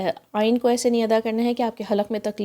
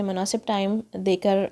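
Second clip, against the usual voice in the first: ordinary delivery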